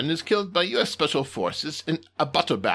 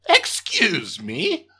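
slow, drawn-out indignant; affronted alien boss; 4 semitones higher, 6 dB louder, much slower than usual. Acted. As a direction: sound offended.

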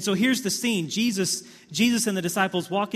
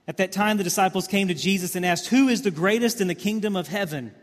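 scripture read aloud; 3 semitones higher, 5 dB louder than usual. Acted. Reading scripture with force.

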